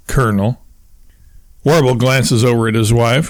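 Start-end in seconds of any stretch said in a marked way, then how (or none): 0.1-0.6 understated sarcastic title. A narrator's reading. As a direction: drop the title with dry, mild sarcasm. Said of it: "adds sarcastically".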